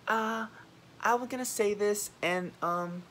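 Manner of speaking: high, timid hemming and hawing; high-pitched, halting mimicry; mock hesitancy. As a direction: mimic a reluctant speaker stalling. Mimicking hesitant speakers in meetings.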